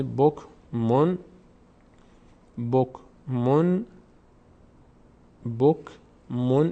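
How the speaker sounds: slow بکمون split as bok, pause, mon; bok... mon, each part set apart; tanvin as -on. Shown as a demonstration. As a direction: drill بکمون in two separate syllables. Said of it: "این تنوین اون هست".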